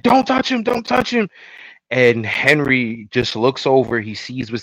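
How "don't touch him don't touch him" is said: higher-pitched panicked plea; a pitched-up, desperate don't touch him; frantic warning. Retold or imitated. Henry points the gun at Joel, warns him frantically.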